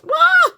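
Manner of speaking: high, loud "wah" of delight; excited squeal. Real, not acted. Thrilled at seeing her sunflowers.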